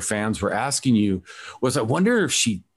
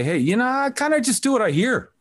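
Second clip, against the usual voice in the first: high casual voice; 14 semitones higher than usual. Retold or imitated. Voicing guest's attitude.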